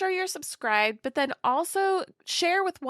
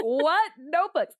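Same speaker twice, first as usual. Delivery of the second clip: confused son; 6 semitones higher, slower than usual. Retold or imitated. Son confused in retold story.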